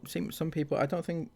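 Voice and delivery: ordinary talk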